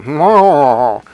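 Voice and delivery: loud, drawn-out nasal honk; nasal mocking noise. Shown as a demonstration. Coach showing a client "you sound like that", nasal noise. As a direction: honk mockingly through the nose. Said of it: "You sound like that".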